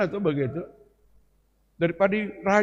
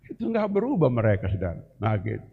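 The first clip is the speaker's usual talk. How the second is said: normal talking voice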